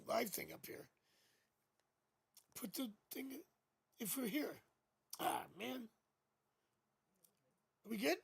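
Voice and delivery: ordinary talk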